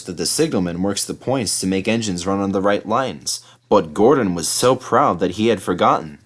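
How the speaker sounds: plain reading voice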